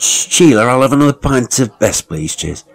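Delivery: a pub regular calling out for another pint; calling out to the bar. Acted. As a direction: call the barmaid over for a pint.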